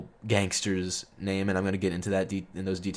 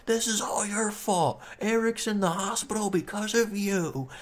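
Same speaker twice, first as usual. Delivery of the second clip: mock accusing women; 10 semitones higher than usual. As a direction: imitate them whining the blame. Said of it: "they start bitching out saying".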